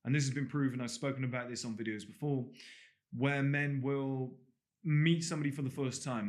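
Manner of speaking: normal talking voice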